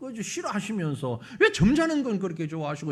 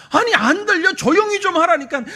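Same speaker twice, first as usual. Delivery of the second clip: angry scolding; 7 semitones higher, 10 dB louder than usual. Acted. Crowd scolding louder.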